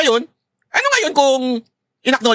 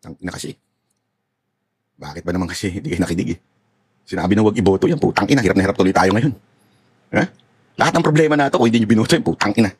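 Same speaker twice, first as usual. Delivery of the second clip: low, grumbling morning rant with curses; low cursing rant; 12 semitones lower than usual. Acted. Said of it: "re-enact ko lang yung katotohanan". (mababang pabulong na galit na pagmumura)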